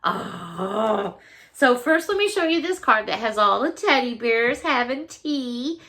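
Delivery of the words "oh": ordinary talk, nothing marked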